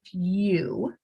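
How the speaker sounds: "Few" said slowly, yoo sound clear; long u in few. Shown as a demonstration. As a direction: repeat "Few" showing the yoo. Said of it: "say that so you can hear the U sound".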